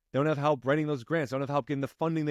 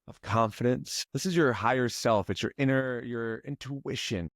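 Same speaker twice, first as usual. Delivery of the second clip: lower, calm, unhurried guiding voice; guided meditation; 4 semitones lower than usual. Acted. Coach guiding client through breathing, calm meditation voice.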